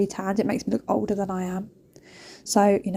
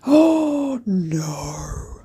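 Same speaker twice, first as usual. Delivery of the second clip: acted dismay. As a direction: draw out a mock-horrified oh no. Caught half-dressed by a dog.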